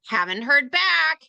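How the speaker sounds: high, drawn-out pestering follow-up; slow, high-pitched parody of a nagging seller; mock hounding. Seller hounding a prospect with follow-up emails, high slow mock pestering. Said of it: "hounding them like".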